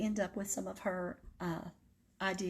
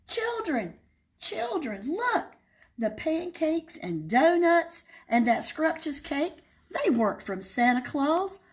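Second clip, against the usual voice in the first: much higher hen voice, loud on Oh; screeching chicken; 8 semitones higher, 4 dB louder than usual. Acted. Mother hen greeting the wolf, high screech. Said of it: "the chicken screeched".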